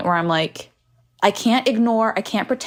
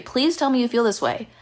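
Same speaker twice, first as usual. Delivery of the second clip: ordinary delivery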